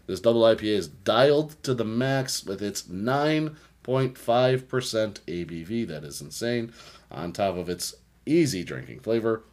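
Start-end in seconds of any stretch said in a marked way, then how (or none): 0.1-5.8 hammed-up label reading, slow. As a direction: read the can like a commercial. Ad copy read.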